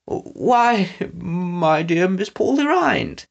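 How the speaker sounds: high, drawn-out nervous politeness. Acted. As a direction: greet her politely, stalling with nerves. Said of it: "Snatcher said nervously".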